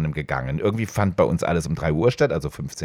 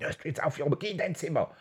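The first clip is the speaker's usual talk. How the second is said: hushed scolding; 8 semitones higher, 9 dB quieter, faster than usual. Retold or imitated. Mother covering receiver, sending him away.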